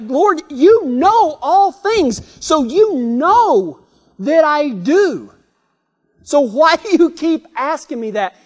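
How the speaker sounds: high, slow exasperated protest; acted frustration. Preacher voices Peter answering Jesus yet again, frustrated. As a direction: stretch out each point, imitating growing frustration. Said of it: "he's kind of getting frustrated by now".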